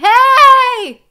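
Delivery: very loud, high strained shout; pushed, cracking yell. Shown as a demonstration. Coach showing over-muscling, yelling and straining into a crack. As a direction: push too hard until the voice cracks. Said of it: "gonna crack like I just did".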